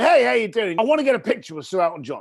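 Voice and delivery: high, loud, fast New York caller; excited New Yorker. Retold or imitated. Eager caller from New York asking for [name], excited. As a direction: gush it out like an eager fan on the phone.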